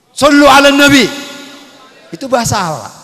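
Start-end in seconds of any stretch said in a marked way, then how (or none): 0.1-1.1 loud, high call for the sholawat; call to the congregation. Acted. Preacher leading the congregation in sholawat, loud call. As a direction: lead the crowd's response, full voice.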